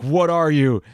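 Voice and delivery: mock demanding researcher. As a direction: blurt the question up high like a scared scientist. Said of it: "asks one of the test subjects".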